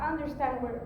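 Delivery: ordinary talk, nothing marked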